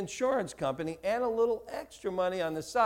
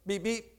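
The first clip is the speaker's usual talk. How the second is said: quick, softer clipped pair of beeps; two short imitated horn beeps; 6 dB quieter than usual. Shown as a demonstration. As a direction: tap out the two short honks with your voice. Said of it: "you beep two shorts".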